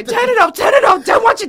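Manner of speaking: high, loud shout to crank the music; mock party shout. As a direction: yell over the music like a fan. Party fan shouting to turn up.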